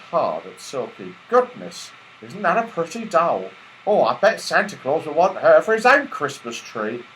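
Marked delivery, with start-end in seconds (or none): 1.3-7.0 high, delighted porter; admiring porter. Acted. Porter marvelling at Silky, high and delighted. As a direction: gush over the pretty doll.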